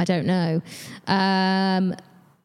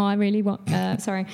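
ordinary talk

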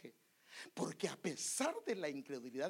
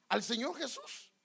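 ordinary talk, nothing marked

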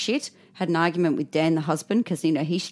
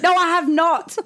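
loud, high indignant no; indignant retort; 11 semitones higher, 5 dB louder than usual. Real, not acted. Snapping back at her husband's cheery morning greeting, loud and indignant. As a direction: fire back, outraged.

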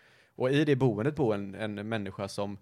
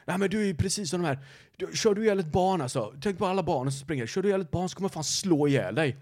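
angry neighbour threat; 6 semitones higher than usual. Retold or imitated. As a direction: threaten like the enraged neighbour, hammed-up.

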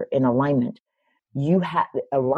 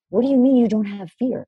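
incredulous challenge; 7 semitones higher than usual. Retold or imitated. Retelling her challenge to mentor.

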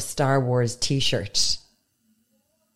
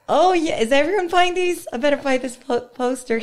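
put-on much higher, louder naive kid voice; naive kid self; 11 semitones higher, 3 dB louder than usual. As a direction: play your clueless younger self, pitched way up. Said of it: "Me not knowing ... going".

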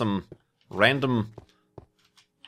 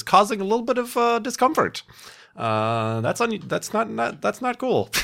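high whiny complaint; 12 semitones higher than usual. Acted. Complaining about earlobe pimple.

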